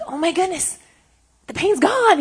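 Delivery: high, thrilled retold exclamation; amazed relief. Retelling a man's healing to the room, amazed and elated. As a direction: act out sudden joyful disbelief, pitched well up.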